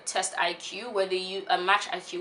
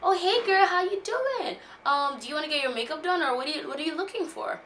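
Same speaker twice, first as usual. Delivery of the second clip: high, bright sales clerk greeting, pitched way up; sassy salesman; 9 semitones higher than usual. Retold or imitated. Makeup artist greeting a shy customer, high and chirpy. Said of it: "he was like".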